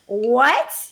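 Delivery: loud, high, rising disbelief; hammed-up shocked question; stunned. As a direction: re-enact being stunned. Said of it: "'Cause I was, like, stunned".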